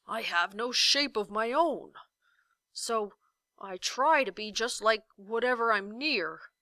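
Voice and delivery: booming but shy giant; a huge creature afraid of notice; timid giant. A narrator's reading. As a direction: giant confessing his shyness. Giant voiced in story.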